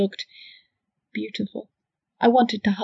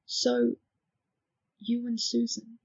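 murmured slowly and much softer; 3 semitones higher, 6 dB quieter, slower than usual. Acted. Justin murmurs to Hannah, looking at a window, slowly. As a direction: let it fall away under the breath. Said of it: "murmured slowly".

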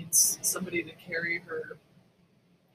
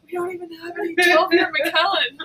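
higher, louder, indignant whine; mock snob complaint; 10 semitones higher, 6 dB louder than usual. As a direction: pout over the missing whisky. Mock rich-person complaint.

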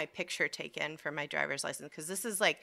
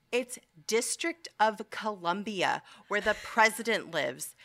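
slowish, mildly raised mock lecture; retold yelling; 4 semitones higher than usual. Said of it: "she just starts yelling at everybody".

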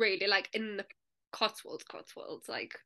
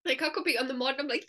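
ordinary talk, nothing marked

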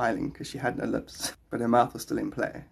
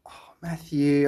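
ordinary talk

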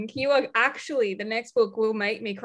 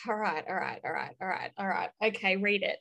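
bracing self quietly; 4 semitones lower, 7 dB quieter than usual. Retold or imitated. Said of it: "and then I'm like".

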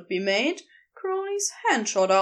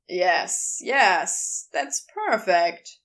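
lower, firmer man's voice; a lower Crowley, teasing and sure; Crowley's voice; 5 semitones lower, much slower than usual. Acted. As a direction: drop low and speak with easy authority.

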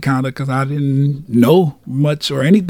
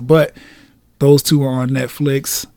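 usual speaking voice

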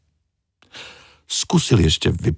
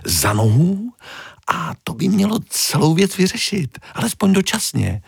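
much higher cartoon fish voice; little fish voice; 13 semitones higher than usual. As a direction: play the tiny helpful fish up high. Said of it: "doplnila malá".

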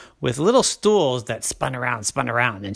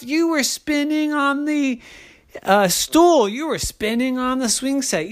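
exasperated dad; 6 semitones higher than usual. A narrator's reading. Daddy protests in acted exchange.

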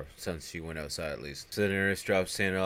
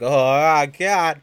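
high, very loud cry of alarm; hammed-up holler of horror; mock panicked yell; 8 semitones higher, 14 dB louder, much slower than usual. Ernest finding snakes all over himself, mock panic. Snakes biting his face.

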